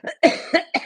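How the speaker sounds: sudden loud coughing. Real, not acted. Coughing fit on stream, loud cough. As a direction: cough hard again. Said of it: "Excuse me".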